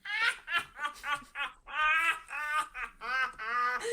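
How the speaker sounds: head-back mocking cackle; loud cackle. [name] throws head back.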